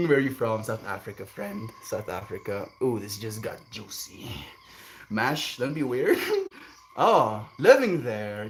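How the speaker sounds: ordinary talk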